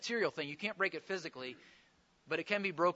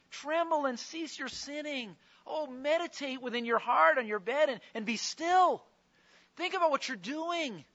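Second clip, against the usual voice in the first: pleading; 8 semitones higher, 3 dB louder than usual. Acted. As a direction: beg them to turn back. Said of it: "he's pleading with them".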